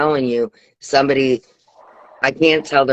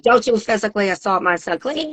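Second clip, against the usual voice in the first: mock angry mom; 6 semitones higher than usual. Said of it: "she's like".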